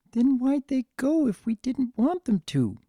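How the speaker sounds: high, small whimpering filly voice; a little hurt, near tears. Acted. Orphaned filly asking her brother why, whimpering and high. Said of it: "Pumpkin whimpered".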